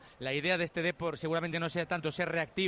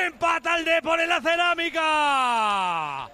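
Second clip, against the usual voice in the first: high, slow, loud sign-off call; slower closing goal call; 9 semitones higher, 8 dB louder, much slower than usual. Real, not acted. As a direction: draw out the final announcement. Repeated call, slower and fading.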